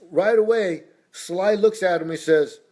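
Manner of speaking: usual speaking voice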